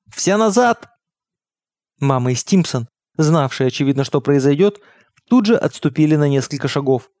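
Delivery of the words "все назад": high-pitched cry of command; narrated shout, raised in pitch more than volume; sudden shout. A narrator's reading. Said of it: "отец вдруг выкрикнул".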